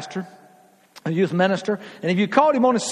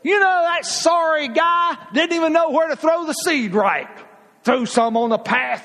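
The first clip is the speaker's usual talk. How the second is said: mock critic; 7 semitones higher than usual. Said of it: "he didn't say".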